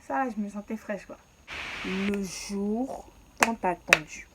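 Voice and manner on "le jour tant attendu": slow, loud, breathy trailer voice; voix de bande-annonce dramatique; dramatic narration. Acted. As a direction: announce it like a movie trailer. Dramatic storytelling announcement.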